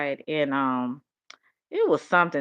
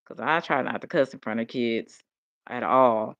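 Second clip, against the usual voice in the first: ordinary talk, nothing marked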